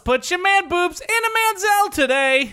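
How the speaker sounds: high, shouted mock commercial voice; ad announcer shout. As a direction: pitch it like a cheesy TV ad. Mock ad for the sponsor.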